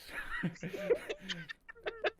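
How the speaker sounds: high giggling; high laugh. Real, not acted.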